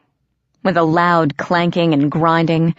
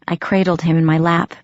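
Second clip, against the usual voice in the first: ordinary delivery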